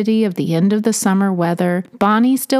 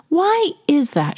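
pondering; 6 semitones higher than usual. Acted. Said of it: "she pondered".

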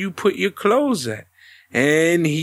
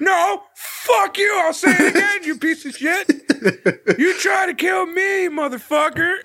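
mock furious yell; 11 semitones higher than usual. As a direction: rant like a wronged wrestler. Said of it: "acted furious wrestler".

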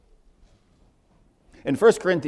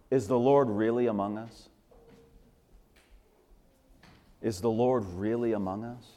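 quiet doubting question; 5 semitones lower, 5 dB quieter than usual. Retold or imitated. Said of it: "they have the audacity to say".

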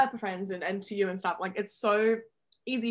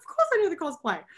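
lightly voiced co-star; 8 semitones higher than usual. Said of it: "She's like".

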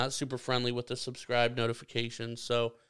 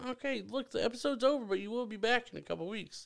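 high, soft pretend comforting; hushed high-pitched mock sympathy; mock consoling; 8 semitones higher, 6 dB quieter, faster than usual. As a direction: soothe a friend as if they were crying, teasingly. Teasing friend as if crying.

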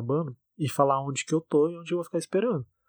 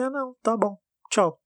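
high-pitched dismissive retold goodbye; retold brush-off; 8 semitones higher, 4 dB louder than usual. Fed-up date ending it with the no-show, dismissive. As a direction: cut them off with a high, mock-agreeable sign-off. Acting out curt break-off with date.

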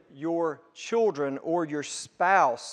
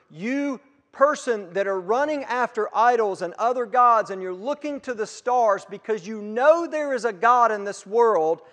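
raised, louder voice of God calling out; 6 semitones higher, 5 dB louder than usual. Retold or imitated. Preacher voicing God calling Abraham, louder and higher. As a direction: proclaim it as God speaking to the wanderer.

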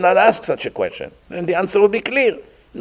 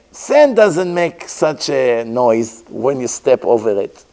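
ordinary delivery

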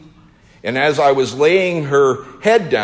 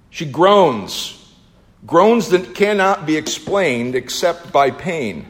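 ordinary talk, nothing marked

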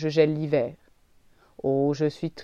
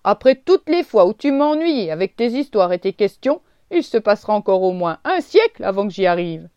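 gruff pretend scolding to hide the grief; grumbling; 11 dB louder than usual. A narrator's reading. The oak grumbling at the leaf to hide his sadness, mock gruff. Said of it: "rouspéta le chêne".

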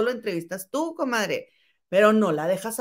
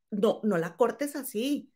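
ordinary talk, nothing marked

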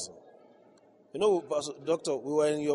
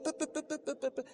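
high, soft mocking pe-pe-pe; belittling pe pe pe noise; 10 semitones higher, 8 dB quieter than usual. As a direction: mimic small coins counted out, high and light. Mocking petty payment sounds.

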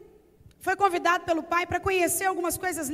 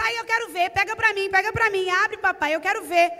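put-on high, louder whiny little boy begging; pleading child; 4 semitones higher, 4 dB louder than usual. Boy begs father to open the cocoon, whiny pleading. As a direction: beg like an eager small child. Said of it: "E o menino começa".

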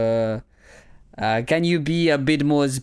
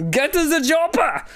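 much higher shouted movie line; mock action-movie yell; Arnold-style shout; 14 semitones higher than usual. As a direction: bellow it like an action hero. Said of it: "me mate Hitler".

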